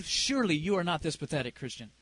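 low, sinking disbelief; dismayed self-reproach. Retold or imitated. Preacher scolding herself over failing fifteen minutes, low dismay. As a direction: mutter it to yourself, dropping away at the end. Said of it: "and I'm like".

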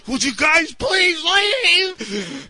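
high, drawn-out begging; exasperated plea. Retold or imitated. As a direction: stretch out a plea for them to go. Pleading with intruding colleagues.

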